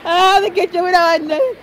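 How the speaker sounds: high, laughing delight; real laughter breaking in. Real, not acted. Laughing at the child's answer.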